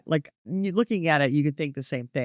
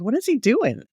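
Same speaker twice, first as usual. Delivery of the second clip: baffled question; 7 semitones higher than usual. Retold or imitated. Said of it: "I was like".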